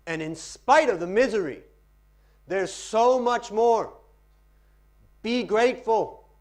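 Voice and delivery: loud, high, soaring recital; dramatic poetry reading. Shown as a demonstration. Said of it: "Practice reading poetry".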